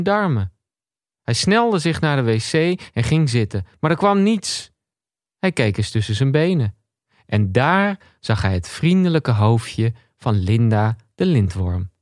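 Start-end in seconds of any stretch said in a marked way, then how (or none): none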